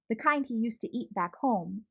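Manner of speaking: ordinary delivery, nothing marked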